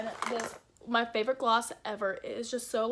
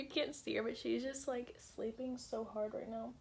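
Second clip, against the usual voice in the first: hushed voice; 10 dB quieter than usual. Real, not acted. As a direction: keep it down for a sleeping friend. Friend asleep beside her.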